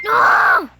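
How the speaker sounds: loud high scream of rage; frustrated scream. Real, not acted. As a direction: scream out at the game. Gamer dies after long silence.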